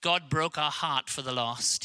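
ordinary delivery

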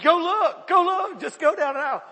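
loud, high urgent order; panicked shout. Acted. Dad sending a bystander to find his son, panicked shout. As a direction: shout the stranger into action.

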